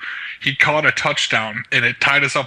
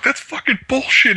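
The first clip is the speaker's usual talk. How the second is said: imitating friend, high; 12 semitones higher than usual. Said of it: "Enigma was like".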